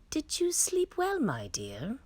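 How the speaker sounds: lightly voiced queen, all innocent concern; queen asking sweetly. A narrator's reading. The queen asks the princess about her night, sweet and high. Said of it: "the queen asked her".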